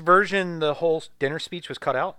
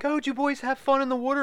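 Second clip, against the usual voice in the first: put-on high-pitched parent asking the boys; mock chirpy parent; 11 semitones higher than usual. Parent greeting the boys after scuba diving, mock chirpy. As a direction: imitate a parent in a raised, sing-song pitch. Parent voiced in retold scene.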